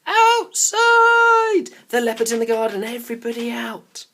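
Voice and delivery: loud, high alarm call. A narrator's reading. Barney calls everyone out of the house, shouting loud. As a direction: rouse the whole house. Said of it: "calling out".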